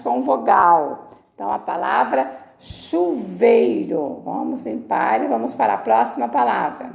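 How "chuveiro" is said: "chuveiro" drawn out as chu-VEI-ro; "chuveiro" slowly, vei as one long glide; syllable emphasis. Shown as a demonstration. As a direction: stretch each syllable of "chuveiro". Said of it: "separou em trissílabo".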